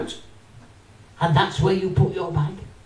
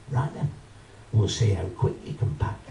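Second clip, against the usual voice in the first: sulky cashier challenge; 12 semitones lower, 7 dB quieter than usual. Retold or imitated. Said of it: "she goes".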